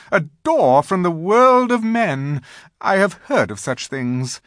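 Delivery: loud exclamation rising high, then easing; queen marvelling aloud. A narrator's reading. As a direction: think aloud in a ringing queenly voice.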